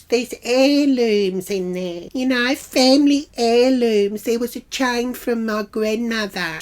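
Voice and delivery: high imitated lament; put-on weepy insistence; mock teary. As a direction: mimic her pleading over lost treasures. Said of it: "She starts getting all teary".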